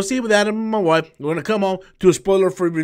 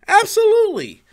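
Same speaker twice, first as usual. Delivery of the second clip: much higher, emphatic Absolutely; excited emphasis. Real, not acted. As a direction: leap up high on Absolutely. Repeating answer emphatically.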